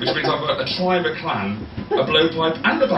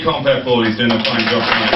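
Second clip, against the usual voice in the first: usual speaking voice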